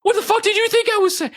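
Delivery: very high, loud mock outraged voice; indignant partner. Partner retorting to the boyfriend who misheard, indignant. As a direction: act out an offended, shrieky retort.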